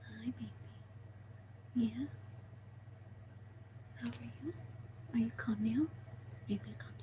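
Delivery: hushed, gentle checking-in; tender near-whisper. Real, not acted. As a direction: coo quietly to the settling animal. Cooing to the calming snake.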